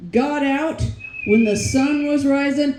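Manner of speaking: ordinary talk, nothing marked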